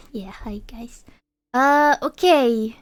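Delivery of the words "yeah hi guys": whispering. Real, not acted. Quiet greeting to chat.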